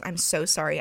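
usual speaking voice

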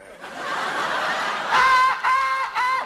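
long high shouted alarm noise; high siren wail. Acted. Marin detector going off, high siren wail.

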